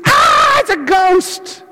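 very high, loud scream, then slow and strained; frightened cry. Retold or imitated. Disciples freaked out by a ghost, screaming in fright. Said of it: "they're freaked out".

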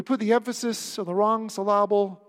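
"syllable" as sil-LAH-ble, loud; wrong-syllable stress. Shown as a demonstration. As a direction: lean on the middle of "syllable". Repeats the wrong-syllable joke.

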